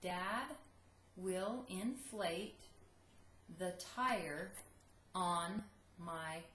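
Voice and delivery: slow, separated words; slow dictation repeat. Shown as a demonstration. Teacher repeating dictation, slow. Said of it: "I'll say it one more time".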